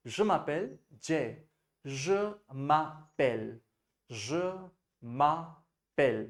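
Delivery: je m'appelle stretched very slowly, je stressed; slow model for repeating. Shown as a demonstration. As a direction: say "je m'appelle" slowly so the class echoes it. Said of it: "एक साथ बोलेंगे". (धीरे, दोहराने के लिए उच्चारण)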